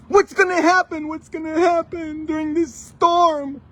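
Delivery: high, loud frightened cry; играть панику перепуганной семьи в бурю; acted panic. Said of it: "everybody is super scared".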